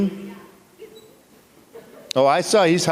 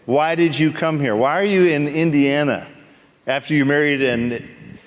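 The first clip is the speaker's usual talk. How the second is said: ordinary talk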